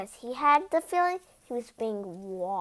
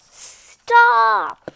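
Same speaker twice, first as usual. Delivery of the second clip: sudden high loud shout; furious royal shout; 8 semitones higher, 11 dB louder than usual. Acted. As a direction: yell it like a king halting the reader. The king shouts at the reader.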